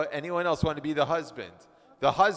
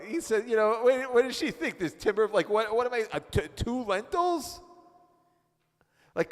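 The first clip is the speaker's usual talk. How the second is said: put-on exasperated Babylonian husband; 8 semitones higher, 4 dB louder than usual. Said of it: "let's read it here".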